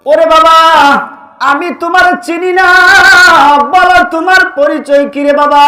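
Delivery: loud, high chanted cry of a distraught mother; acted melodic wail to a stranger. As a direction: call out at full voice, holding the last syllables. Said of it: "মা ডাক দিয়ে বলেন".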